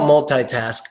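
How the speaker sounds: "multitask" as mul-TIE-task; TIE variant. Shown as a demonstration. Teacher gives example sentence, multitask with TIE. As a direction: say "multitask" with "eye" in the middle. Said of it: "many different ways to say".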